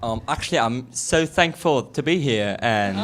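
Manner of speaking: loud, drawn-out British accent attempt; careful, slow go at British. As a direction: put on a British accent for the group. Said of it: "tasked with doing a British accent".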